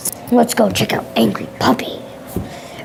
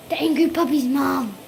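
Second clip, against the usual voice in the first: normal talking voice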